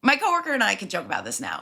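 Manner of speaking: usual speaking voice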